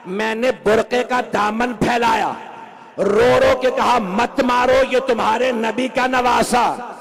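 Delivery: high, loud wailing sermon voice; preaching lament. Acted. Preacher wailing Zainab's lament to the mourners. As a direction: climb into a keening cry of grief.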